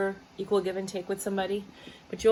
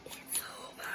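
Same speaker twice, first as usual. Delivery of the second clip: soft unvoiced whisper of finality; whispered verdict; 16 dB quieter than usual. Real, not acted. Reader whispers the card's verdict to the viewer. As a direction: whisper the end like a secret.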